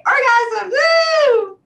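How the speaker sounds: ordinary talk, nothing marked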